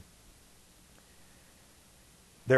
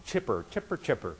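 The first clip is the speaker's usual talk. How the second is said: chipper; 6 dB quieter than usual. Retold or imitated. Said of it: "just like Snoopy, just hopping".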